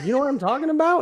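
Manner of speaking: high, laughing question to the nurse; laughing disbelief. Retold or imitated. To the giggling nurse, a high laughing question. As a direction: ask it through a laugh.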